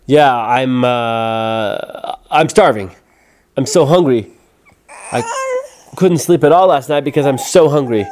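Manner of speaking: loud, hesitant then insistent pleading; stall, then plead hunger; nervous little trickster overdoing it; scared excuse. Acted. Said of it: "looked at him, scared".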